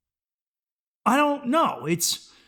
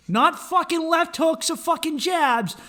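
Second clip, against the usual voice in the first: normal talking voice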